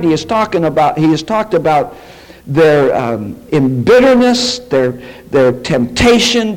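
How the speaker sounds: normal talking voice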